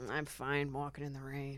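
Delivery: soft, low, understated brush-off; retold as a quiet aside; muttered excuse. Declining company as the rain starts, muttered excuse.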